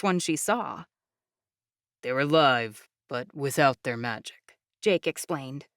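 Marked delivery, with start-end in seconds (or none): 2.0-4.6 low, calm proud explainer voice; proud young man. Acted. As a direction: speak low and proudly steady. Jake explains.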